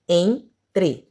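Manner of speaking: "Entre" split into en, tre; two separate beats on "Entre"; syllable by syllable. Shown as a demonstration. Teacher showing two syllables, "Entre" broken in two. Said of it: "duas sílabas".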